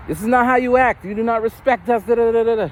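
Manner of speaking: lightly imitated scolding Spanish teacher. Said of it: "she's just in a thick accent".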